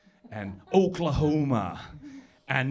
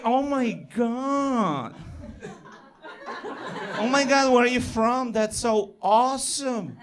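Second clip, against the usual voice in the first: high-pitched, drawn-out American gush; gushing American woman; 10 semitones higher than usual. Retold or imitated. American woman thrilled by a foreign accent, gushing high. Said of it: "She's like".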